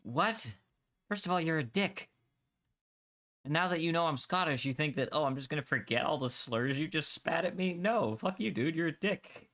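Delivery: half-acted outrage at a rude gamer; retold angry retort. Telling off the rude French gamer in a high, heated voice. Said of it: "I'm like".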